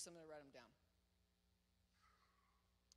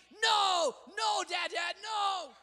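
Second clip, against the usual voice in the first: put-on high, loud shouted protest of a two-year-old; child's loud refusal; toddler shouting no; 8 semitones higher, 12 dB louder, much slower than usual. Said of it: "And he's telling me".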